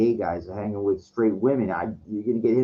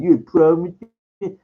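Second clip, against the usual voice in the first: retold threat; 9 semitones higher, 7 dB louder than usual. Retold confrontation, dude threatens.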